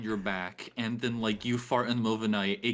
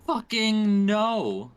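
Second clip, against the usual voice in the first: emphatic no; 8 semitones higher, 5 dB louder, much slower than usual. Real, not acted. Flat emphatic denial.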